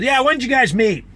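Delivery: hammed-up impression of a rude official; rude officer. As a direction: imitate a curt officer barking a question. Rude officer questioning him.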